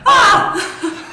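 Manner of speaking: sudden high burst of laughter; big laughing cry; loud laugh. Real, not acted. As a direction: let out a loud delighted laugh. Laughing outburst after joke.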